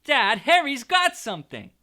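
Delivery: put-on loud, high whiny child's voice; Dudley tattling. A narrator's reading. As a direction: tell on Harry loudly. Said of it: "said Dudley suddenly".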